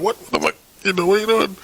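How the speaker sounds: high-pitched, tripping over the words; mock a thrown-off, bewildered protest; flustered stutter. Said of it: "start stuttering".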